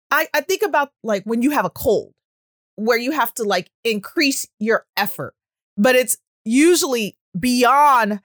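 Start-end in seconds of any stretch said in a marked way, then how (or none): none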